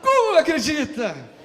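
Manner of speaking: high, loud, laughing through the words; laughing disbelief. Retold or imitated. Pastor reage com riso. (riso incrédulo, alto e agudo)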